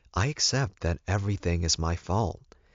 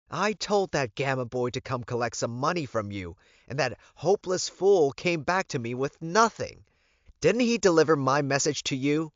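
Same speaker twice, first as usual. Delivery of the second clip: higher and louder, indignant complaint; angry demand; 9 semitones higher, 6 dB louder than usual. A narrator's reading. Elizabeth confronting the Alpha over the money, indignant. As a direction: storm in and demand your money.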